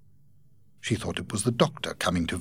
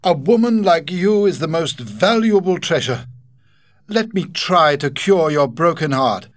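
higher, louder, fervent proposal slowing at the end; ardent Godfrey proposing; 9 semitones higher, 8 dB louder than usual. Acted. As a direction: kneel and ask for her hand. Godfrey proposes on his knees.

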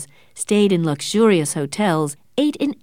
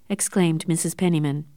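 usual speaking voice

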